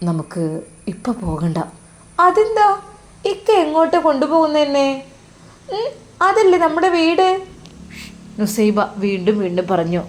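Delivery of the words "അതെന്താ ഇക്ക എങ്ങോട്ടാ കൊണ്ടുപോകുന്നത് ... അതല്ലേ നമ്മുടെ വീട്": put-on higher, louder young wife's voice, puzzled; a young wife asking where the road went, pitched high; worried young wife. Wife Nusaiba asking her husband about the wrong road, high and worried.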